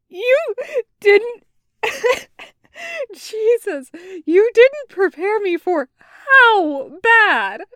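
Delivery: high, loud, broken-up words between laughs; acted laughing to tears. A narrator's reading. Taco complaining about the awful accent, laughing to tears. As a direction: try to talk, collapse into laughter, restart. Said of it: "tears in his eyes from laughing".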